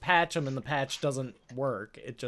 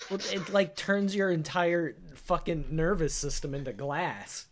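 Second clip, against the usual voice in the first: normal talking voice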